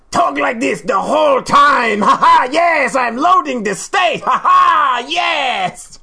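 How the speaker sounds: loud, high, booming pirate-like gamer; hammed-up shouting with big fake laughs; voice-straining Let's Player. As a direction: bellow it like an over-the-top streamer. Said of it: "how are you not blowing your voice out".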